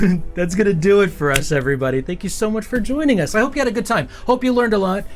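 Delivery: cheery stream outro to viewers; show sign-off. Acted. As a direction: wrap up the stream like a broadcast host. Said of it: "I gotta do my sign off real quick".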